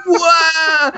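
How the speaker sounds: long, high cry of whoa; excited whoa. Retold or imitated. Fan yelling at the camera, high drawn-out whoa. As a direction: wail it out like a cartoon reaction. Close-up whoa at each other.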